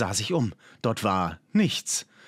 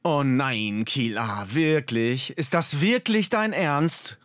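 dismayed disbelief, slowing and climbing; calling out; 3 semitones higher, slower than usual. A narrator's reading. Friend realising what the explorer was after, dismayed exclamation. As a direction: exclaim it, drawn out, incredulous. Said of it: "rief Gim nach kurzem Nachdenken".

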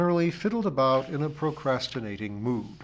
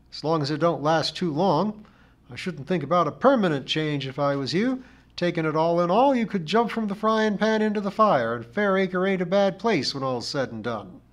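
raised, louder complainer's voice; opinionated char lady; 6 semitones higher, 6 dB louder than usual. A narrator's reading. Missus Pringle gives the narrator her views, opinionated. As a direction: hold forth as the village char lady.

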